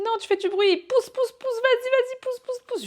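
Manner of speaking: high, frantic mock cheering; urgent cheering. Partner urging his wife to push, high and frantic.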